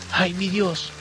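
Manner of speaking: a high, plaintive lament; crying out. A narrator's reading. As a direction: cry out to heaven, forsaken. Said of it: "clamó la desamparada". (clamor desamparado)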